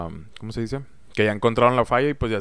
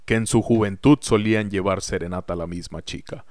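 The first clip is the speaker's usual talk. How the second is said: story narration; slower than usual. Acted. Narrator introduces the sketch.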